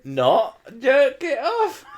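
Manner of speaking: very high, slow laughing; laughing retort. Real, not acted. Answering his partner, high and laughing. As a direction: squeal it through laughter.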